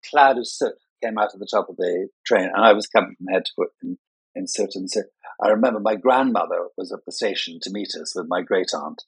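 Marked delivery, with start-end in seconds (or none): none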